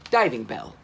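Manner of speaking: plain reading voice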